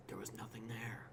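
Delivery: soft eerie whisper; spooky whisper. Pretend. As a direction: hush it like a ghost story.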